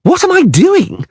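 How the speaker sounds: high, slow outraged yell; bard yelling. Acted. Dandelion yelling at Geralt, high indignant yell. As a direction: throw the question back indignantly. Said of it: "the bard yelled".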